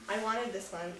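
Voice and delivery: normal talking voice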